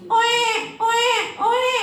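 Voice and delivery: playful mimicked infant crying; baby cry imitation.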